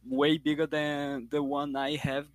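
ordinary talk, nothing marked

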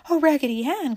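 high, mildly alarmed appeal; pleading cry. A narrator's reading. French doll begging Raggedy to stop the soldier, high pleading cry. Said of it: "cried the French doll".

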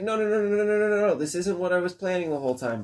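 high, rushed no no no; frantic, caught-out denial; panicked denial. Retold or imitated. Bird denies plotting, panicked.

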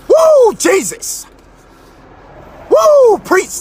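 high, drawn-out joyful whoop; excited whoop. Real, not acted. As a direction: whoop like you're catching the spirit. Hyped up, praising like church.

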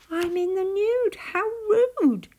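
high, flustered little-creature voice; high-pitched storybook embarrassment; embarrassed. A narrator's reading. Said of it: "he blushed".

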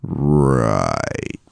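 low, long drawn-out "right"; mock serious. Friend hamming up the serious voice, mock solemn.

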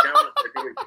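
name said through high laughter; laughing. Real, not acted. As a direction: giggle out the name. Laughing at own suggestion.

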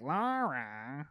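whiny drawn-out cartoonish call; Steve Urkel. Comic showing how Urkel talked, mock nerdy whine. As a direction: mimic a nerdy sitcom voice, stretched out. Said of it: "Steve Urkel was like".

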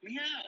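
cat meow. Acted.

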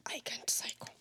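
whispered confession. Real, not acted. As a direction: whisper it sheepishly. Confessing she can't cycle.

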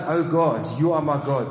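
a fervent cry to God; calling out. Retold or imitated. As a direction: lift the voice and call out the psalm. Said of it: "He calls out".

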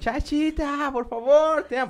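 high, wailing lovesick begging; hammed-up weeping; imitating his own crying plea; mock crying plea. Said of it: "Pero llorando".

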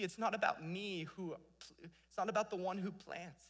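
ordinary delivery

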